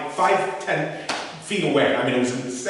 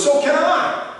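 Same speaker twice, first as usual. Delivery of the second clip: higher, louder mock uncle with a twinkle, snapping back; sly uncle punchline; 5 dB louder, slower than usual. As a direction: deliver the uncle's comeback with devilish glee. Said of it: "He goes".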